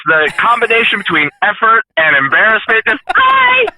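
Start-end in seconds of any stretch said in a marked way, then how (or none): 3.1-3.7 a very high, embarrassed squeak; high-pitched hi. Retold or imitated. Trucker re-enacting his greeting to the cop, squeaky high hi. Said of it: "So that's why it went high pitched".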